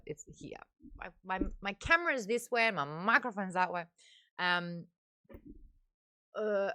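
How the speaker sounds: ordinary talk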